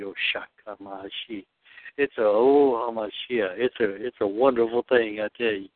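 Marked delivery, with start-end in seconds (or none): none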